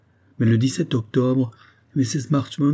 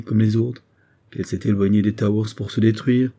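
ordinary delivery

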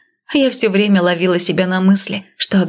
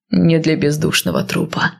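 low, grim man's voice; gruff warrior, low and steady; stern northerner; 3 semitones lower than usual. Acted. Male lead voiced lower.

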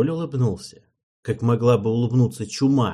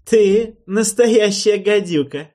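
loud, high, slow approving king's voice; 10 semitones higher, 9 dB louder, slower than usual. Acted. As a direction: praise her bite, not scold. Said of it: "тоном, более одобрительным, чем укоризненным".

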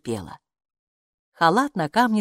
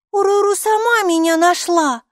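indignant protest, much higher and louder; arguing; 13 semitones higher, 6 dB louder than usual. A narrator's reading. Girl argues the Buka found her herself, indignant. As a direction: insist you are right. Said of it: "заспорила Майки".

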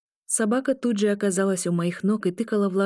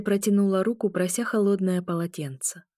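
ordinary delivery

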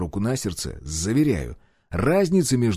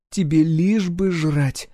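slow, higher mocking wife voice; smirking wife; 6 semitones higher, slower than usual. A narrator's reading. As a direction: tease him with a smirk. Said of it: "усмехнулась жена".

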